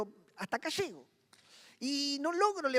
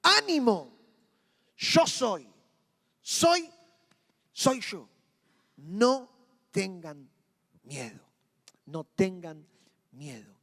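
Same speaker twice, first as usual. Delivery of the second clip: Jesus reassuring; 3 semitones lower, 7 dB louder, much slower than usual. Acted. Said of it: "Jesús les dijo".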